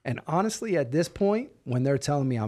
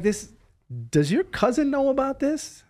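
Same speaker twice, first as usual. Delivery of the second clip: much higher, louder stunned question; shocked disbelief; 12 semitones higher, 4 dB louder than usual. Retold or imitated. Prisoner asks the lawyer in shock, pitched high. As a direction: jolt up in outraged surprise.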